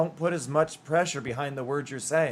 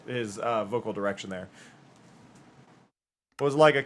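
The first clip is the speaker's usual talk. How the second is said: normal talking voice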